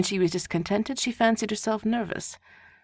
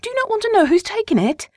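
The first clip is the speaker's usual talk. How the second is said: much higher, louder impatient cry; 8 dB louder than usual. A narrator's reading. Wife impatiently crying out to silent husband. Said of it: "cried his wife impatiently".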